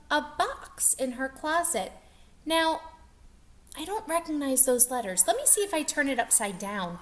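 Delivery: ordinary delivery, nothing marked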